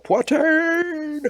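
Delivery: very high, long drawn-out yell; battle cry. Acted. Player couching a lance to charge, yelling out a battle cry. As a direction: bellow it as a charging war cry. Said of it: "and yell out".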